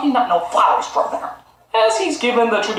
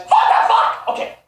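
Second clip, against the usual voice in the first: ordinary talk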